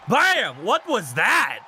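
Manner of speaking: punchy exclamation with big pitch swoops; excited shout. Real, not acted. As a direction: call out a shocking hit. Calling a surprising hit in match.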